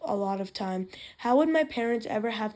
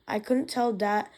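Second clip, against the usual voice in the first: usual speaking voice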